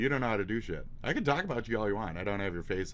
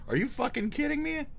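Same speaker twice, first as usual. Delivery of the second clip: pitched way up in stunned disbelief; high, amazed outburst; incredulous excitement; 3 dB louder than usual. Shown as a demonstration. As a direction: squeal it like you can't believe your eyes. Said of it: "I'm just saying, "Are you fucking kidding me?" a bunch".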